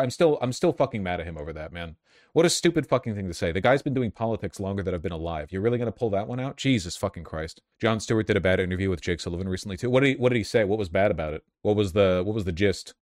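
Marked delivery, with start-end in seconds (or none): none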